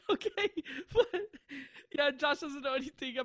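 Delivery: high laughing while talking; giggling. Real, not acted. Explaining Josh hasn't heard the story, still giggling. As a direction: giggle through the words.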